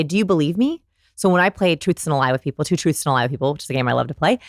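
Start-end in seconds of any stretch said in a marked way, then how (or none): 0.0-0.8 "me" rising in a question; asking the lie. Acted. Voicing liars who doubt their words, questioning rise. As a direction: lift "me" as if seeking belief. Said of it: "they ask their lie".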